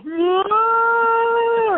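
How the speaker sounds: a sudden high crying wail, mimicked; imitated child's wail. Little brother starting push-ups, imitated high crying wail. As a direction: burst into a kid's howl. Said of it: "started crying".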